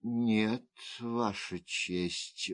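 soft, low, slowing sigh; resigned, softly sighed denial; sighing reply. A narrator's reading. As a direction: answer with a weary sigh. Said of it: "вздохнула госпожа Го".

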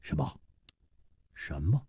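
hushed, stunned double question; taken aback. Acted. Song Gang startled by the odd condition, taken aback. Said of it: "宋刚不禁愣了愣".

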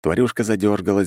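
usual speaking voice

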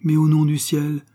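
indignant wife demands the truth. A narrator's reading. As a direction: flare up in indignation. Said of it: "belles d'indignation".

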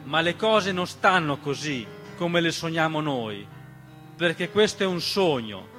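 usual speaking voice

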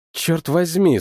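usual speaking voice

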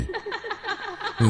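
high, far-off, fading ghostly cackle; a wicked ghost woman laughing down into the pit; eerie ghost laugh. Acted. The ghost laughs down at the greedy men, a high eerie cackle. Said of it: "Những tiếng cười để man dợ".

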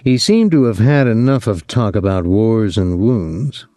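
ordinary delivery, nothing marked

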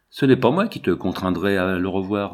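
plain reading voice

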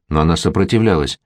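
ordinary delivery

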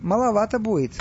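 high-pitched sneering remark; mocking. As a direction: taunt with a smirk. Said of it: "глумливо улыбаясь". (глумливо, с ухмылкой)

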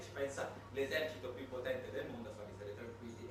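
ordinary talk, nothing marked

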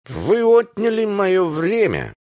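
put-on high, slow indignant drunk cry; drunken outrage. A narrator's reading. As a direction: wail it, wounded and tipsy. Drunk Anthony protesting loudly. (высоко, медленно, пьяное возмущение)

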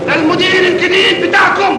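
slow, high-pitched shouted announcement; drawn-out announcing shout; calling out to crowd. Acted. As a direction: shout it out slowly to the whole crowd. Announcing new manager to workers.

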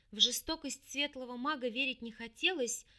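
ordinary delivery, nothing marked

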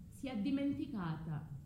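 normal talking voice

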